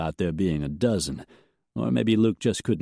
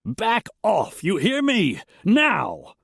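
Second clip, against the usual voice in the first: much higher, loud, ending in a shout; desperate order; 12 semitones higher, 3 dB louder, slower than usual. A narrator's reading. The rancher orders his gunman to back off, shouting. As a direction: bark the order like a desperate boss. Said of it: "held a note of desperation".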